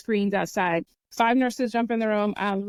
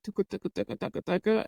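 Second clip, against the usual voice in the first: heartbeat imitation; 4 dB quieter, faster than usual.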